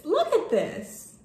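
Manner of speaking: real awed delight. Real, not acted. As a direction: show it off, savouring each word. Excited showing the letter.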